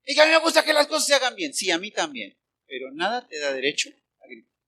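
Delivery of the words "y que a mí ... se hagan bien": fast, high, loud rant; mock perfectionist boss. Preacher imitating a fussy boss, fast high rant.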